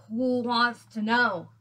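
slow, drawn-out growly challenge; suspicious guard dog growl. A narrator's reading. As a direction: growl it slowly at an intruder. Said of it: "growled a voice from inside".

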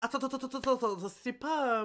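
high, loud rapid repeats; excited stammer at the screen; frantic. Real, not acted. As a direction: pile up the words in a panic. Frantic reaction to the episode.

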